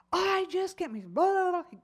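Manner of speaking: loud, high mock rant; imitated angry yelling. Man out of his car after a fender bender, mock angry yelling. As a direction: mimic an angry driver blowing up. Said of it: "very angry, and began yelling and screaming".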